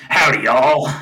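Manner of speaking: loud cowboy drawl; hammed-up cowboy greeting. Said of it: "I literally popped in going like".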